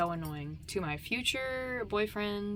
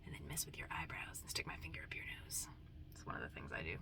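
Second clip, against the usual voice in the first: whisper; 14 semitones lower, 6 dB quieter, faster than usual. Pretend. Whispered affectionate threats.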